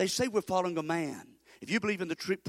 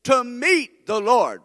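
to meet the Lord, drawn out slow and loud; slow emphatic preaching; 5 semitones higher, 10 dB louder, much slower than usual. Shown as a demonstration. Preacher leading the church, slow and loud. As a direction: stretch every word of to meet the Lord. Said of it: "Say that with me".

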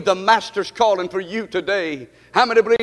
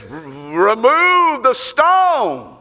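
voice of Jesus; much slower than usual. Acted. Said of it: "and Jesus says".

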